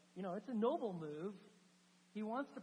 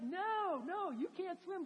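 alarmed protest; 10 semitones higher, 4 dB louder, slower than usual. Retold or imitated. Frodo protesting from boat.

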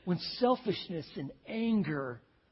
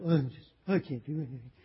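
lower, self-pitying mumble; mock helpless sulk; 4 semitones lower than usual. Ahab answering his scolding wife, mock helpless sulking. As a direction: play the helpless sulker.

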